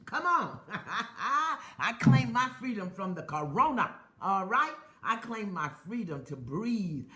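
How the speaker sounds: rising, fervent call to claim freedom; preaching affirmations. Acted. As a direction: preach each line like a declaration. Leads affirmation chant.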